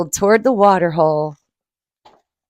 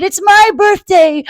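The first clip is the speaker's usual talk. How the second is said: tearful rage; 10 semitones higher, 6 dB louder than usual. Acted. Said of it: "tears of rage".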